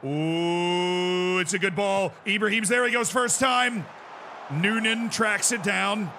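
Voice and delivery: excited commentator building to a roaring goal call; high, shouted goal commentary; live play-by-play. Pretend. Mock goal commentary on the match.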